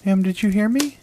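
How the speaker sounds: put-on high little boy voice; small boy asking, a bit insistent; child's voice. A narrator's reading. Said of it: "The voice sounded like a child's".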